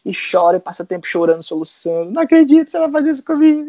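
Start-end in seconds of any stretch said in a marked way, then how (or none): 2.1-3.6 high, fast, loud wailing protest; tearful friend. Retold or imitated. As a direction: plead like a heartbroken friend. Mari crying at the news.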